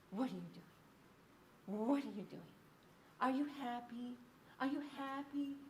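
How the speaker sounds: soft, lilting questions, each one repeated; repetitive baby talk. Shown as a demonstration. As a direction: repeat it gently to an infant, pausing between. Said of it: "So there's repetition".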